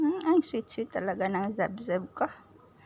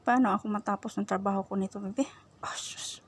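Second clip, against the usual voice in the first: usual speaking voice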